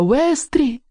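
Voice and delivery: very high, rising call. Acted. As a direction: call his name high, seeking help. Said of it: "позвала я". (высокий, тревожный зов)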